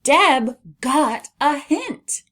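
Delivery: slow, high, loud exclamation; excited reading. Shown as a demonstration. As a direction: read it with an exclamation to your voice. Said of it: "read this with an exclamation".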